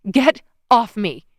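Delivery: slow, loud desperate cry; panicked shout. A narrator's reading. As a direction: shove the words out in panic. Fighting off attacker.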